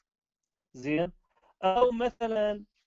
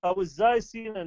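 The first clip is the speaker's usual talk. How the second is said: ordinary talk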